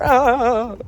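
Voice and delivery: high, loud "ah" scream; overdone high-pitched holler; over-the-top yell. Real, not acted. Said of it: "Sorry, I'm doing too much".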